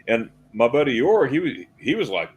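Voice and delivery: ordinary talk, nothing marked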